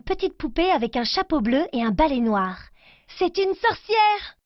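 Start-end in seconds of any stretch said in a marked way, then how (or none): 3.2-4.4 high, slow and amazed; amazed discovery. Acted. Girl unwrapping her godfather's gift, amazed. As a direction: gasp it out, wide-eyed at the doll.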